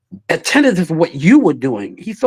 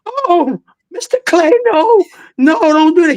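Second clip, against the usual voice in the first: much higher, crying-out mock alarm; hammed-up panic over the violin; panicked pleading; 11 semitones higher than usual. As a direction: beg him to put it down, horrified. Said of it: "the concert master came in".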